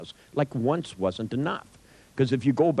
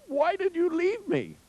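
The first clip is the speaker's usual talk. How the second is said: pitched-up, whiny mock lament; mock pleading cry. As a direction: ham up an abandoned son's wail. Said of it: "starts crying".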